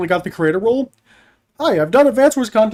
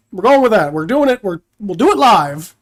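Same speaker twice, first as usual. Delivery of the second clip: normal talking voice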